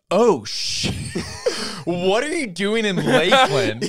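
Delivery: surprised fan. Retold or imitated. Fans surprised seeing the guests.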